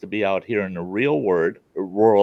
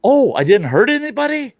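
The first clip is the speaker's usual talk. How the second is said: mock surprise; 12 semitones higher, 4 dB louder than usual. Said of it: "retold reply to cop".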